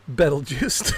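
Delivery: Betelgeuse said as "BETTLE-juice"; short "bet" vowel opening Betelgeuse; misspelled pronunciation. Shown as a demonstration. Showing a friend the typo, mispronounced Betelgeuse. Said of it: "but I wrote down Betel".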